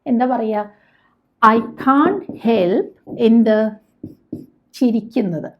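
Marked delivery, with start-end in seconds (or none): 1.4-2.9 very slow, each word drawn out; slow model phrase. Shown as a demonstration. Teacher modelling a phrase for Malayalam learners, very slow. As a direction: spell it out slowly for learners. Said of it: "teacher models English phrase".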